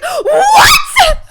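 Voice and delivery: high shocked scream with a laugh; a laughing shriek of shock. Retold or imitated. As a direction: shriek it in disbelief. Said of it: "I literally screamed".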